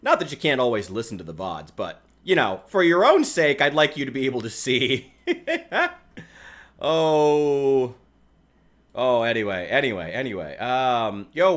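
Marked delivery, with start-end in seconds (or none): 5.2-5.9 short high-pitched laugh. Real, not acted. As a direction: burst out laughing. Laughing after joke.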